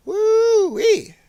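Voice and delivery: high-pitched "woo-wee" whoop; playful whoop. Pretend. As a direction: let out a silly high whoop. Whooping while resizing the screen.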